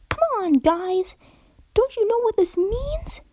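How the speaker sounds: very high, insistent bubbly pony; excited high pony demanding an answer; demanding Pinkie Pie. Acted. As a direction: push the friends for an answer, high and eager. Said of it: "demanded Pinkie Pie".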